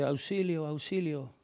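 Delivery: soft, half-hearted «auxilio», the opposite of a shout; a weak, timid call for help; feeble cry for help. Shown as a demonstration. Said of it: "Va a activar toda su voz para gritar". (pedir auxilio sin fuerza, casi en voz baja)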